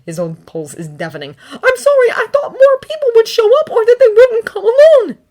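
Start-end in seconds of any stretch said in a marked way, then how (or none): 1.5-5.2 high, loud strained apology; anxious apology. A narrator's reading. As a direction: apologise in a frantic rush. Anxious apology mid-fight.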